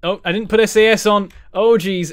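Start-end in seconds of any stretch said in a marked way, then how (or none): none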